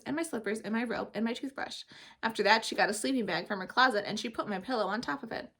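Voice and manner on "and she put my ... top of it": ordinary delivery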